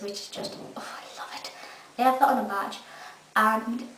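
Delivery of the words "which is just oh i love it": breathless fangirl whisper; swooning whisper. Real, not acted. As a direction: whisper it like a secret crush. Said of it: "fan swooning over catchphrase".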